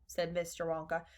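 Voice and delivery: usual speaking voice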